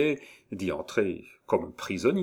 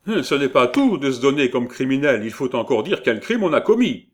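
loud, raised-pitch scolding; impatient; 5 semitones higher, 7 dB louder than usual. A narrator's reading. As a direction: lecture him in exasperation. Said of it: "impatienté". (impatienté, voix forte)

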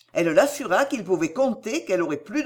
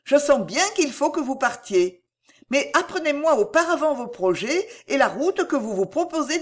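lightly voiced earnest heroine, higher pitch; higher character voice, mildly entreating; earnest Cécile; 7 semitones higher than usual. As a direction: press him gently in a raised feminine register.

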